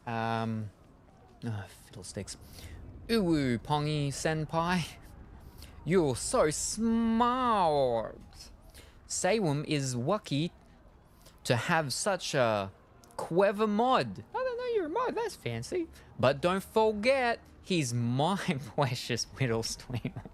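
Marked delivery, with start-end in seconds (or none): 3.1-14.2 slow, high cutesy uwu voice; breathy cutesy giggly. Pretend. Reading cutesy chat message aloud. 14.3-15.9 squeaky high voice. Pretend. 16.2-20.2 breathy cutesy giggly. Pretend. Continuing cutesy chat reading.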